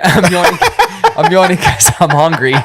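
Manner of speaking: laughing through the correction. Real, not acted. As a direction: giggle as he fixes his slip. Laughs correcting his slip.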